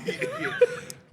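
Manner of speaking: delighted laugh. Real, not acted. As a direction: crack up. Laughs at hockey joke.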